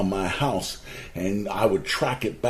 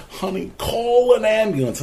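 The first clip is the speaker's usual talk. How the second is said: much higher, urgent retold plea; urgent call; 12 semitones higher, 4 dB louder than usual. Dying husband telling his wife to call help, urgent high voice.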